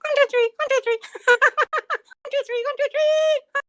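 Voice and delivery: very high squeaky voice; Elmo voice. Acted. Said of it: "Elmo has a high squeaky voice".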